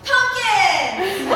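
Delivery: put-on high, loud kid's yell; little boy yelling. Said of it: "little boy's voice ... that yells".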